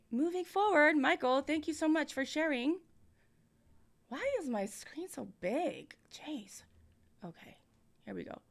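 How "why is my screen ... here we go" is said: whispered to himself, frustrated; whispered aside. Real, not acted. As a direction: mutter it under your breath. Muttering to self off mic.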